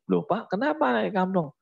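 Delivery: fast, high mother scolding; a mother confronting her husband, high and quick; wife to husband: why hit the child, high fast scold. Acted. Mother scolds father in story.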